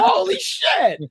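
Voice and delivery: slow drawn-out high mock awe; awed holy shit. Moviegoer stunned by the scene, slow high awe. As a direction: stretch it out in stunned wonder. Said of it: "It's like".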